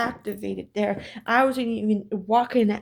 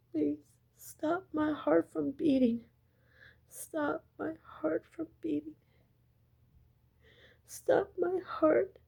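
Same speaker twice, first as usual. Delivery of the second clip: begging plea; 5 semitones higher, 5 dB quieter than usual. Retold or imitated. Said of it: "she was begging God".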